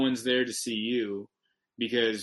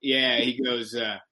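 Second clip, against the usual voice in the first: ordinary delivery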